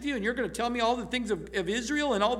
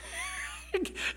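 amazed laugh; 9 dB quieter than usual. Real, not acted. Preacher amazed at Samuel's words.

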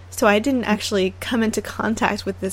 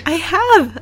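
excited; 8 semitones higher, 5 dB louder than usual. Real, not acted. Proudly announces first onigiri made.

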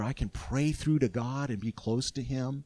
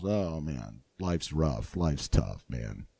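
mopey complaint; 9 semitones lower, slower than usual. Retold or imitated. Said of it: "you're walking around".

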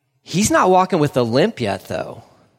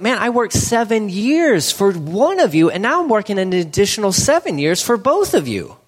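frustrated; 6 semitones higher than usual. Acted. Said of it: "he's so frustrated 'cause he's like".

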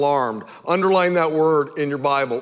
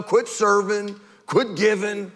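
normal talking voice

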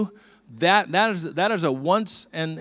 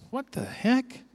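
higher-pitched mock bewilderment; retold startled puzzlement; 7 semitones higher, slower than usual. Man retelling his look in the mirror, startled. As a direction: re-enact a baffled double take.

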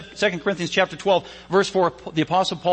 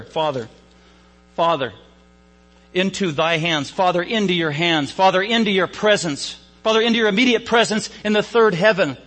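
fervent prayer; 4 semitones higher, 4 dB louder than usual. Acted. Preacher voicing Jesus' last prayer to the Father, building fervour. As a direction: let each Father climb higher and louder.

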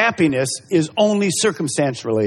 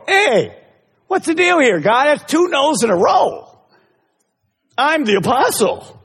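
higher, indignant protest; mock angry complaint; 8 semitones higher than usual. Paul protesting God's two refusals, mock angry and higher. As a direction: pretend Paul throwing a fit at God. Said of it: "Is Paul gonna get angry and go".

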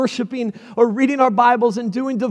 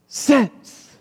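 usual speaking voice

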